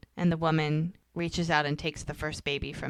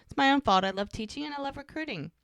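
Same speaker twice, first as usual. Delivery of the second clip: higher, lightly voiced woman's reply; voicing the female teacher; 6 semitones higher than usual. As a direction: mimic her breezy self-explanation, pitched up.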